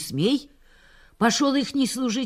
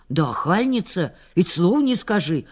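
ordinary delivery, nothing marked

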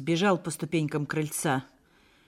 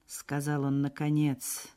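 usual speaking voice

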